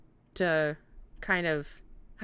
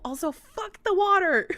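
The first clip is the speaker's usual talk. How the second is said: loud, high laugh-shout; 13 semitones higher, 8 dB louder than usual. Real, not acted. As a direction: blurt it out laughing. Laughing outburst.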